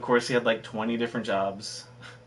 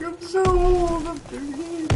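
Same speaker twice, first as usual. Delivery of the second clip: put-on much higher, slow, whiny old-man lament; whiny mock complaint; slower than usual. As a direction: ham up complaining about being old, stretched out. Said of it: "I'm like".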